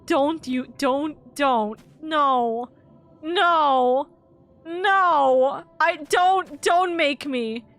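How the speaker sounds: high, frantic begging; desperate pleading. Real, not acted. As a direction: plead with the game, near tears. Begging game not to force kill.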